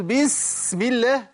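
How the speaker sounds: بسم with a long over-stretched hiss, high and very slow; mock the over-hissed S in بسم; a hammed-up whistling S; exaggerated hissing S. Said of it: "نيجي في القرآن ونقول".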